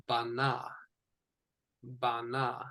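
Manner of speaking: bana, "ba" then "na"; Hebrew pronunciation. Shown as a demonstration. Teacher pronouncing bana for the student, careful. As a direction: say bana with both syllables clear. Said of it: "It is pronounced".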